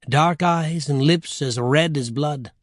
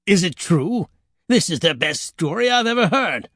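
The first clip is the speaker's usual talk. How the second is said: higher, delighted devil voice; lightly voiced devil enthusiasm; excited devil; 4 semitones higher than usual. Devil enjoying the children's story, excited.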